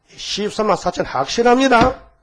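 loud, high declaration; emphatic report. Acted. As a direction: proclaim it proudly upward, stretching the end. Voiced report to the Father.

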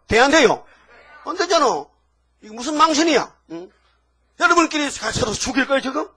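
loud, high, hammering preacher's rebuke; fiery preaching. Acted. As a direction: drive it home like a scolding pulpit. Preacher scolds congregation, loud.

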